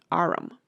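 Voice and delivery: "arum" said in two syllables, ar-um. A narrator's reading. Pat says arm as arum, two syllables. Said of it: "He pronounced it".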